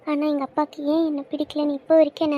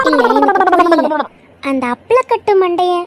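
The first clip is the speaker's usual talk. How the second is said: much louder, a bit higher; loud indignant outburst; 3 semitones higher, 8 dB louder than usual. Acted. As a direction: erupt in mock disbelief. Outburst against friend's father.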